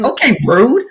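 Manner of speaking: retold indignant huff at being snubbed; mock offence. Acted reaction to student's snub.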